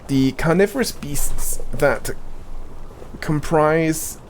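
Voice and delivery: measured, solemn NPC reading; game dialogue reading. Acted. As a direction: read it like a quest-giver's briefing. Reads game NPC dialogue aloud.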